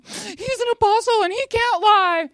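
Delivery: very high-pitched, strained proclamation; imitated preacher's yell. Speaker quoting a church elder's talk, high imitated yell. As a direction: cry it out as an impassioned imitation. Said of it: "he's, like, yelling things like".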